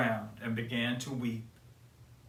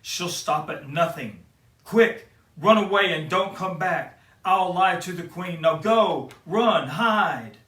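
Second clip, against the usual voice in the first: louder, higher urgent huntsman; urgent warning; 5 semitones higher, 10 dB louder than usual. Acted. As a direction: hurry her off into the woods, loud and pressing.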